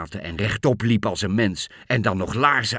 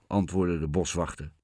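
ordinary delivery, nothing marked

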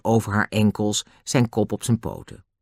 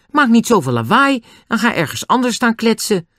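high, raised voice telling off; scolding; 11 semitones higher, 4 dB louder than usual. A narrator's reading. Julian tells two noisy men off, loud scolding. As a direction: scold them from the doorway.

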